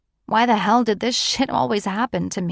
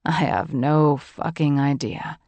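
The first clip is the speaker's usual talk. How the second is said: a hint of a chuckle in a deeper voice; amused John; 5 semitones lower than usual. A narrator's reading. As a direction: lower man's voice with a light amused edge. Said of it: "he said humorously".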